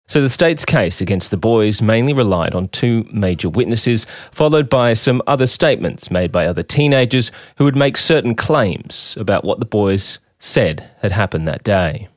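measured documentary voice-over; narration read. Acted. Host narrating the case to listeners, documentary read. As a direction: read it like a true crime narrator.

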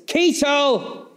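sudden high barked call of a name; retold shout. Hitler calling his general down the corridor, high sharp shout. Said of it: "Hitler shouts down the corridor".

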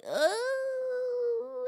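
high strained moan; pained groan. Retold or imitated. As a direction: moan through a contraction. Groan in pain at hospital.

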